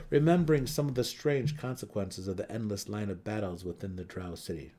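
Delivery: usual speaking voice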